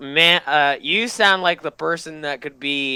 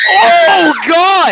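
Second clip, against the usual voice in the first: loud, high startled cry; real shock at the scream; shocked shout; 11 semitones higher, 10 dB louder than usual. Real, not acted. Reacts to scream.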